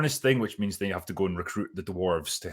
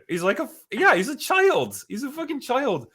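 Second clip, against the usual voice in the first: exasperated disbelief; 4 dB louder than usual. Real, not acted. Exasperated rant about Durin.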